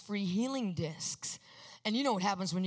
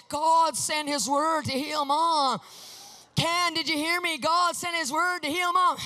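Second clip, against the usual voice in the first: slow, loud, higher, hammering each word; insistent shout; 5 semitones higher, 6 dB louder, slower than usual. Retold or imitated. Pressing Ken over and over, a slow insistent shout. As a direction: drive it into her like a dog on a bone. I was like a dog. I kept on going at it.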